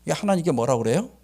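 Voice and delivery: ordinary talk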